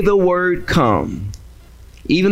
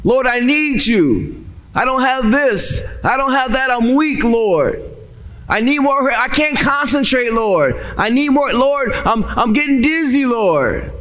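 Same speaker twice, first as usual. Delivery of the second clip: high, desperate pleading; a starving man begging for help, weakening; crying out to God; 7 semitones higher than usual. Acted. Man dying beside a feast begs God, desperate plea. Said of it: "he's crying out to God".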